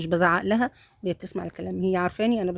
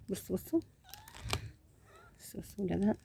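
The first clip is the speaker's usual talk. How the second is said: much softer, murmured; hushed; 13 dB quieter than usual. Real, not acted. Pointing out the kittens to viewers, hushed. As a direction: keep it barely audible near the kittens.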